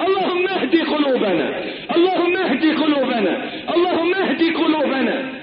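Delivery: high, pleading preacher's cry; fervent prayer. Acted. Preacher prays for guided hearts, high fervent pleading. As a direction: repeat the plea in a high voice, falling at each end.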